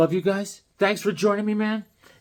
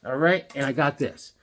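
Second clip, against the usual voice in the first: usual speaking voice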